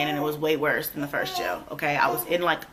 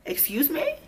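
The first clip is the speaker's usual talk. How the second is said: high, questioning mock disbelief; retold disbelief; 10 semitones higher than usual. Replaying her reply to the canceled bond, incredulous. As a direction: lift it sharply into an incredulous question.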